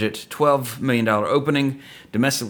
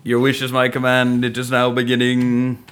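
genie voice; 2 semitones higher, 4 dB louder than usual. Pretend. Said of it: "Sound like Galaxy Quest".

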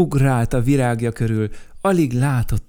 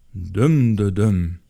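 creature voice; 4 dB louder, much slower than usual. Acted. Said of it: "mondta ekkor Dömdödöm".

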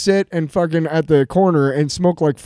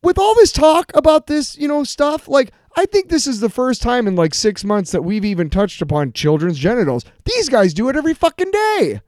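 high-pitched indignant rant; real raised-voice outburst; worked-up and loud; 7 semitones higher than usual. Real, not acted. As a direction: let your voice climb as you get carried away ranting. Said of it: "Sorry, I got a little loud".

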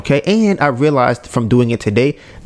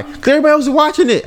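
very high, rushed mock protest; squeaky-high mocking excuse; mimicked girlfriend; much faster than usual. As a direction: imitate her insisting, high and fast.

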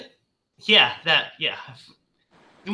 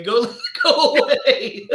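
laughing through the words; 7 semitones higher, much slower than usual. Real, not acted. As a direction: giggle it out, drawn slowly. Laughing through a mock brush-off.

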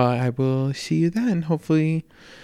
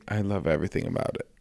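usual speaking voice

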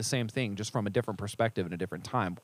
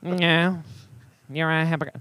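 smug, higher sneering voice; snobby skeptic; 4 semitones higher than usual. Acted. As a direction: scoff like a know-it-all critic. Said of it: "that's my snobby skeptic impression".

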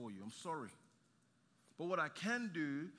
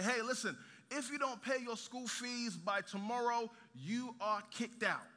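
raised, emphatic school ultimatum; voiced official threat, pitched up; stern warning; 6 semitones higher than usual. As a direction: lay down the ultimatum, slowing on the last words. School's warning letter voiced in story.

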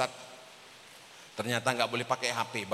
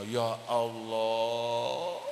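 hushed awe; 3 semitones lower, much slower than usual. Retold or imitated. Awed reaction to the story. (takjub lirih, dipanjangkan)

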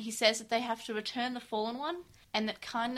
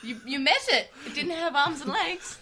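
laughing; 5 semitones higher, 7 dB louder than usual. Real, not acted. Laughing at Tai line.

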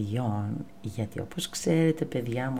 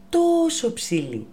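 amazed; 8 semitones higher, 4 dB louder than usual. A narrator's reading. Marvels at giraffe's height.

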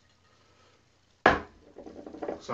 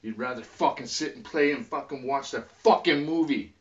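ordinary talk

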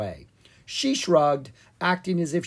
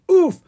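short, high, loud oof; comic grunt on a hard landing; impact oof; 12 dB louder than usual. A narrator's reading. Bouncing down a hill.

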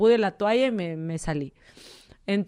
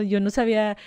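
ordinary talk

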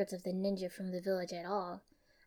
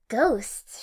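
mock-startled, louder question; feigned shock; 3 semitones higher, 10 dB louder than usual. A narrator's reading. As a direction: fake being startled. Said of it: "she feigned shock".

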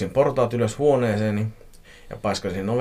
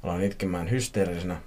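ordinary delivery

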